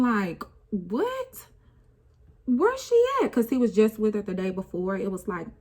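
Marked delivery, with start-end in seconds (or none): 0.7-3.3 put-on very high, loud child voice; a kid who can't take it in; high startled child question; confused little boy. Son's confused reaction voiced.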